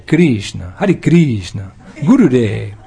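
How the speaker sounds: mock Russian chanting, Hare as hadi; Russian accent. Teacher mimics Russian devotees chanting names in a Russian accent. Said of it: "like they say in Russia".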